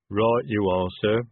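slow, heavy troll voice; lightly voiced troll.

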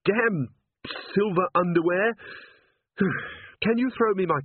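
high, pained cursing, then a sigh; whimper becoming voice. A narrator's reading. Angua recovers behind the crates, cursing in pain. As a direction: force words out through a whimper. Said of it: "by degrees became a voice".

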